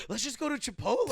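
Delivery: high mock suggestion; Shay. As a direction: imitate your friend in a high-pitched voice. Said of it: "And Shay goes".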